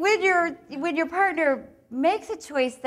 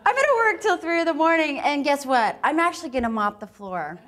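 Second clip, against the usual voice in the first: much higher and louder, gushing overeager yes; a bartender told to work late, overjoyed; 6 semitones higher, 6 dB louder than usual. Acted. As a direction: throw yourself into it like a thrilled bartender.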